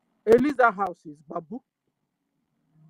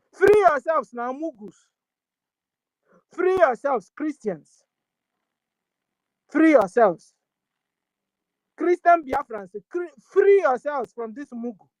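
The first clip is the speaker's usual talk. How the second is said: loud, urgent exhortation with raised pitch; rallying cry; 3 semitones higher, 6 dB louder than usual. Real, not acted. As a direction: call out forcefully to rouse the people. Rallying Christians to free themselves.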